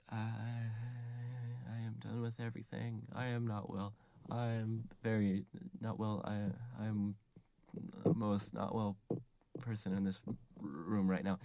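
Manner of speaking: very soft, halting monotone; quietly broken, with pauses between phrases; mock traumatised. Toby after all the murders, hushed and traumatised. Traumatised boy declares himself unwell.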